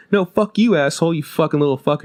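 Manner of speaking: cuss like a cute little alien; cute kid voice. Retold or imitated. Alien kid insulting the player, high cute voice. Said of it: "this really cute voice".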